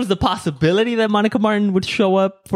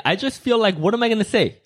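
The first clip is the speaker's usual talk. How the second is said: normal talking voice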